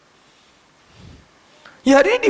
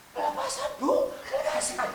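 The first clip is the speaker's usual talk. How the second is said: put-on high, soft, small child voice, drawn out; timid child; 10 dB quieter than usual. Pupil softly telling the teacher it is deliverance, high and small. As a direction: answer like a shy pupil, high and hushed.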